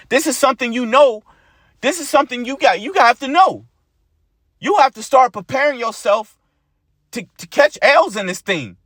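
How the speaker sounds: rally the brothers to keep going; fired-up preaching. Acted.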